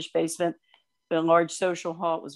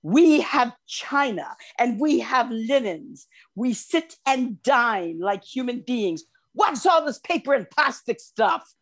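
imitating an indignant rabbi, higher, slow and emphatic; mock outraged speech, pitched up; raised voice; 5 semitones higher, slower than usual. Australian rabbi scolding over paper plates, raised voice. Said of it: "his voice raised like twenty decibels".

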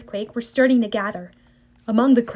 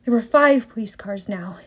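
usual speaking voice

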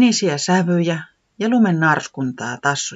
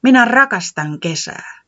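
louder happy exclamation; exclaiming; 8 semitones higher, 5 dB louder than usual. Acted. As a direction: blurt out your love of summer. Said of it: "huudahti Kati A Kansa".

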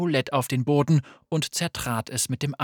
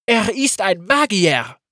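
loud, high, slow accusing call; 6 semitones higher, 9 dB louder than usual. Acted. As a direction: cry it out as an accusation. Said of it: "rief Saba".

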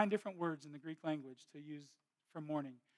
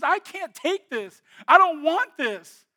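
anguished plea; 12 semitones higher, 21 dB louder, slower than usual. Retold or imitated. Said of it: "And she goes".

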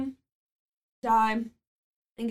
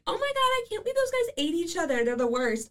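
mocking shocked people; 8 semitones higher than usual. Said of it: "they're all like".

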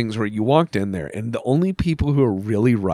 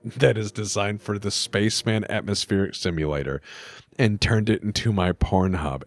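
deadly serious; 8 semitones lower, 7 dB quieter than usual. Retold or imitated. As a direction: quietly imitate someone gravely offended. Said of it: "are very serious about that thing".

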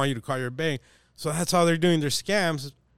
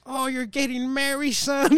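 high-pitched mock surprise, louder at the end; teasing put-on voice; 11 semitones higher, 3 dB louder than usual. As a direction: squeal it up to wind the caller up. Retold teasing of a phone caller.

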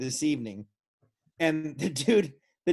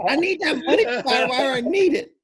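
high, loud laughing "I need that money"; giggly mock begging; laughing plea; 8 semitones higher, 5 dB louder than usual. Real, not acted. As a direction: beg the sponsor while cracking up. Joking plea to sponsor, laughing.

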